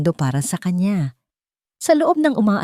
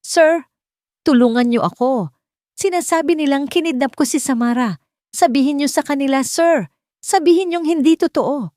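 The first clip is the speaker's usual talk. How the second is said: desperate plea; 9 semitones higher than usual. Acted. Jovelyn pleading to be believed.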